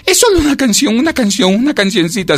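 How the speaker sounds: high, loud begging; pleading. A narrator's reading. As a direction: wheedle with repeated little pleas. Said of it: "suplicó la cigarra".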